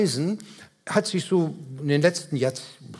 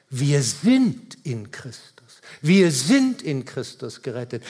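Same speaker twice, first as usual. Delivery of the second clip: "sind" stretched out and lifted; stress on SIND; 3 semitones lower than usual. Real, not acted. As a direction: lean long on "sind", then drop away. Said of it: "Das, was Paulus aber betont". („sind“ gedehnt und gehoben betont)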